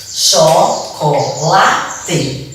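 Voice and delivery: slow Chocolate, cho, co, la, te; four evenly separated beats on Chocolate; syllable by syllable. Shown as a demonstration. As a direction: break Chocolate into its pieces. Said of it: "sílaba por sílaba".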